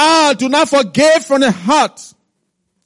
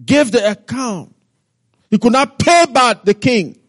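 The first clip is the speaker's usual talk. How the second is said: usual speaking voice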